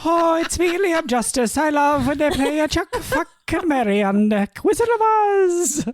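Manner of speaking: very high, hammed-up Irish brogue; Liam Justice, Irish accent. Podcaster announcing himself as the reviewer, in exaggerated Irish. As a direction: belt out a silly high mock-Irish voice. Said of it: "questionable attempt at an Irish accent".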